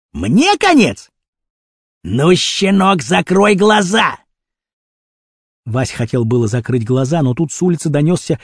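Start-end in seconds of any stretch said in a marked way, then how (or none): 0.1-4.2 high question into slow order; menacing threat. A narrator's reading. Kurochkin tells the pup to close his eyes, menacing. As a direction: echo it indignantly, then threaten slowly. Villain threatens boy with end.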